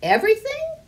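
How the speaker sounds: very high, loud astonished question; surprised child. A narrator's reading. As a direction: gasp it out in wonder. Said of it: "asked Harriet".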